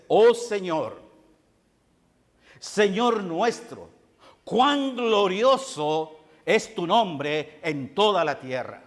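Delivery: slow, swelling recital of the psalm; fervent psalm recital. Acted. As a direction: proclaim each word of the psalm, slowly. Pastor recites psalm with fervor. (recitación lenta y fervorosa del salmo)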